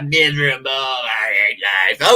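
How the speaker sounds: slow, hammed-up moping whine; imitating a miserable complainer, drawn out; mock misery. Mocking gloomy people who hate life, with a sulky whine.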